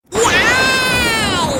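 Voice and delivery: short sharp startled cry; startled yell. Acted. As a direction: yelp out in shock. Sudden scream.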